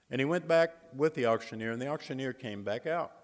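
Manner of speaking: usual speaking voice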